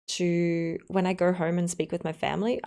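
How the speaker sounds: usual speaking voice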